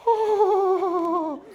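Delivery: long, loud, high "ohhh"; high drawn-out mock gasp; imitated audience gasp. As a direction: mimic a whole crowd's shocked cry. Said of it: "everybody in the audience is going".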